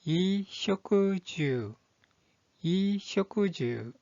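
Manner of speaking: 이쇼쿠주 said very slowly, vowels drawn out; pronunciation demo. Shown as a demonstration. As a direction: model 이쇼쿠주 slowly for learners, syllable by syllable. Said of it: "이 한자의 발음은".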